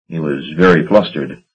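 plain reading voice

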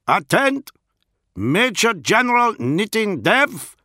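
put-on high, loud herald in a thick Iskian accent; Iskian accent call-out. A narrator's reading. Lucifer guard announces those summoned, calling out in a thick Iskian accent. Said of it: "called out in his thick Iskian accent".

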